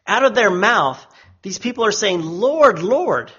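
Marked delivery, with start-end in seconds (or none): 2.2-3.3 higher, emphatic repetition of "Lord"; each "Lord" called out with weight; emphatic calling. Acted. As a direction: lean hard on each "Lord", pitch raised. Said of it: "even emphatically when it's repeated twice".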